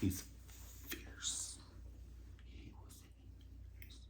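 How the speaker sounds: hushed, breathy praise; awed whisper. Real, not acted. Praising the djembe drummer to viewers, whispering. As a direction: whisper it like a precious secret.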